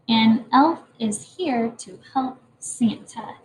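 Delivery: slow and careful, word by word; slow reading aloud. Shown as a demonstration. Teacher reading the finished sentence slowly to the class. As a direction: read the whole sentence slowly. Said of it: "That's my sentence for you today".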